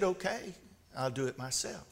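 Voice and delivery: soft, low resigned acceptance; hushed storybook resignation; resigned chicken. A narrator's reading. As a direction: let it drop quietly, as if giving up on help. Chicken resigned after everyone refuses.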